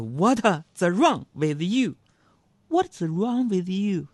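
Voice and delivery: slow mock-angry English; retold indignant English. Young Chinese man challenging the foreigner, mock indignant. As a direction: draw out a milder outraged challenge. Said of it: "非常气愤地说".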